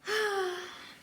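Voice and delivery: high, tired sigh; weary sigh. Acted. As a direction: let out a put-upon sigh. Summoned again, weary.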